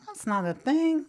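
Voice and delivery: ordinary delivery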